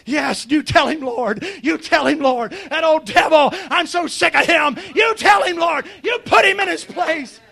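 mildly worked-up cheering on; getting excited. Acted. Prophet urging the Lord against the devil, growing excited. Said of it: "he's getting excited".

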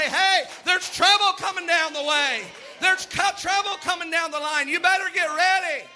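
a preacher voicing the watchman, calling out a warning; watchman's warning shout. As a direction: cry it out from the watchtower. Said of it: "stand up in the watchtower and say".

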